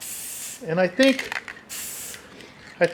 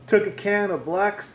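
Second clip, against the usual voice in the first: usual speaking voice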